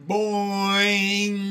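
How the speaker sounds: loud, high, long held boing; playful drawn-out boing. A narrator's reading. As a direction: stretch the boing out as far as it goes. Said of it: "uttered a long, drawn-out".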